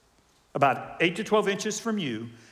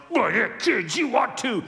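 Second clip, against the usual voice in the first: mock outburst; 7 semitones higher, 6 dB louder than usual. Voices his own baby reactions.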